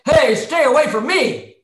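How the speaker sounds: loud, drawn-out warning; scared defensive shout. Shown as a demonstration. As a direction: shout to ward off an attacker. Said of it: "we do that when we're scared".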